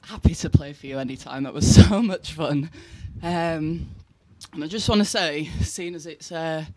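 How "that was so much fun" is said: loud, laughing through it. Real, not acted. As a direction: breaks into a laugh midway. Second take, with a laugh.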